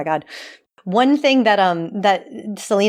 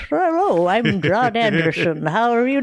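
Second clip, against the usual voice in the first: put-on old man voice. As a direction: do the old man with a little whistle. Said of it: "He kinda talks like this".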